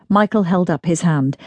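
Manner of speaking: plain reading voice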